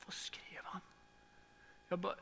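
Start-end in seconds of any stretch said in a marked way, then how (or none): 0.1-0.8 breathless unvoiced whisper; shocked whisper. Retelling reading the hateful comment, whispering in shock. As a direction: whisper it in disbelief.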